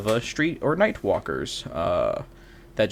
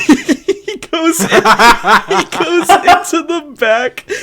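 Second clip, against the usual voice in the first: laughing; 8 dB louder, much slower than usual. Real, not acted. Laughing through the story.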